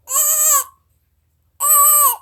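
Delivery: high, loud fawn bleats. Shown as a demonstration. Showing how a fawn goes, high loud bleating. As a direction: bleat twice like a little fawn. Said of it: "a fawn goes".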